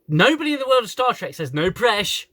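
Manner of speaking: high, loud, rapid complaint; exasperated rant. Real, not acted. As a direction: protest loudly at the slang. Ranting about slang in Star Trek.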